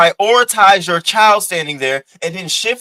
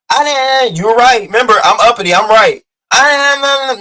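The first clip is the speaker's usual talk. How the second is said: mock defiant shouting; 6 semitones higher, 7 dB louder than usual. Imitating uppity talking over her accuser, loud mock defiance. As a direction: imitate someone loudly insisting on being right. Mocks the woman arguing back loudly.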